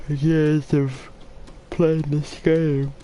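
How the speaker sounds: talking through a yawn; slow yawn-stretched words. Real, not acted. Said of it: "sorry I keep yawning as well".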